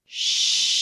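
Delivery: a hushed "shh"; shushing. Retold or imitated. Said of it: "She says".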